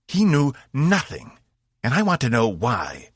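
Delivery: a proud fighter betrayed and furious; angry alien warrior. A narrator's reading. Zenfor confronts Cass.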